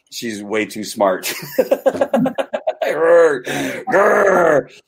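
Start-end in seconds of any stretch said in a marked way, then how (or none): 2.8-4.8 playful frustrated growl, pitched up and loud; mock growl. Husband growling in mock frustration at his too-smart wife. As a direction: growl at her in jest.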